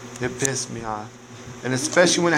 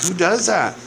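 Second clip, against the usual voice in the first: ordinary talk, nothing marked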